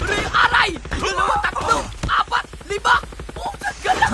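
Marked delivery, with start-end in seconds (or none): 3.3-4.1 shrieking curse when hit; high exasperated yelp. Real, not acted. Player getting shot, high frustrated yelp. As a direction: squeal it out in frustration.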